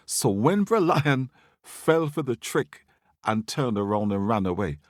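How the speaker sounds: usual speaking voice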